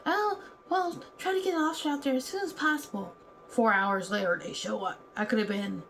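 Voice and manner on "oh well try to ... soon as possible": higher and fast, offhand dispatcher; mock dispatcher. The dispatcher answering the caller, high offhand mock. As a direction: brush the caller off like a bored dispatcher.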